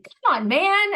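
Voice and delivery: put-on high, loud kid's come on man; son's exasperated come on man, mock-imitated; imitating son. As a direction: mimic a frustrated little boy. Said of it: "my son ... started saying".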